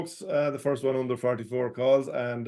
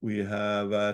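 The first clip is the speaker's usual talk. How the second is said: ordinary talk, nothing marked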